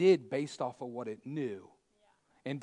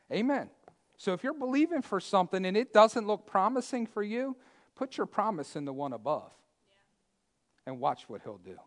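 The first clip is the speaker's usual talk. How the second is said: normal talking voice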